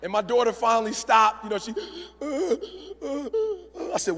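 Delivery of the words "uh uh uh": high, soft hiccuping sobs; mock crying winding down in gulps; imitated sobbing. Daughter finally stopping her crying, mock sobs. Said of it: "my girl's crying".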